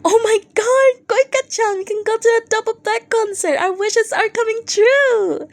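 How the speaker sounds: overjoyed younger fan squealing to a friend; excited fan voice. Acted. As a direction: gush like a thrilled kid who just got tickets. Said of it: "Kenji-kun".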